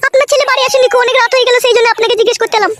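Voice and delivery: put-on high-pitched, deferential young wife voice; put-on high wifely voice, politely worried; respectful daughter-in-law. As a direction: explain yourself to father-in-law in a high voice.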